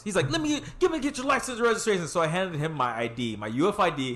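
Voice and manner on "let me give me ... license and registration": high-pitched questioning cop voice; imitated cop. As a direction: play the officer, pitched well up. Acts out cop demanding papers.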